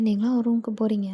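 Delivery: ordinary delivery, nothing marked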